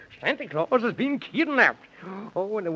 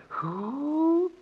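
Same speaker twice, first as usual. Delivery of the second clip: higher hooting owl who; 6 semitones higher than usual. Retold or imitated. Mocking the boy's question as an owl's hoot, a light hoot. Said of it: "Only owls say".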